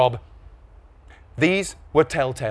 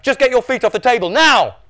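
high, loud command; exasperated order; 10 semitones higher, 7 dB louder than usual. A narrator's reading. Dad ordering Norm's feet down, exasperated. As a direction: build to a shouted final word.

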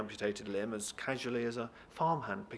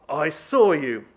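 slow, very loud roar; higher, drawn-out angry shout; furious accusation; 4 semitones higher, 14 dB louder, slower than usual. Acted. Deputy catches a guard stealing, furious shout. Van Cleef accuses a thief.

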